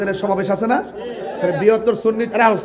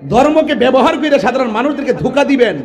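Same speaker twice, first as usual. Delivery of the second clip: higher, louder sermon at full force; fiery preaching; 9 semitones higher, 8 dB louder than usual. Acted. Preacher denouncing politicians to the rally, shouting. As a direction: rouse the crowd, building to shouts.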